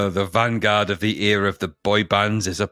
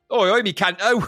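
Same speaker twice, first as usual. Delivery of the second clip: a higher jokey Cockney oi-oi; Cockney geezer; 10 semitones higher than usual. Retold or imitated. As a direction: greet like a cheeky London geezer. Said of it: "does a Cockney accent now and again".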